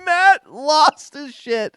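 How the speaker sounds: high, loud, slow, near laughter; gleeful stretched-out exclamation; cracking up. Real, not acted. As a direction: shout the punchline while breaking up laughing. Excited climax of a funny story.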